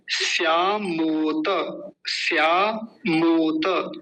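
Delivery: श्यामोत slowly as syaa-mo-ta; full final ta. Shown as a demonstration. Teacher introducing the next word, slow careful pronunciation. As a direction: stretch each syllable of श्यामोत, sounding the last vowel. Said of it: "त को पूरा रखेंगे".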